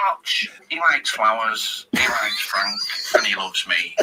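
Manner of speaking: slow, low, deliberate manager voice; Clough impression. Manager explaining to Arch what the player likes, slow and certain. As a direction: spell it out slowly, imitating the gaffer.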